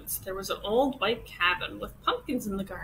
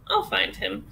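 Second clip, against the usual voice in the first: ordinary delivery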